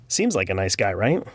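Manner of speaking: ordinary delivery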